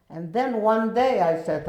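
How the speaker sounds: usual speaking voice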